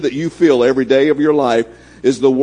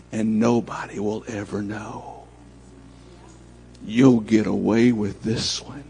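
sly temptation; 5 semitones lower, 8 dB quieter than usual. Retold or imitated. Satan's tempting line.

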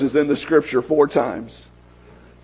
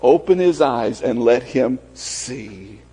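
prayer; 6 semitones lower, 3 dB quieter than usual. Acted. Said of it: "He said".

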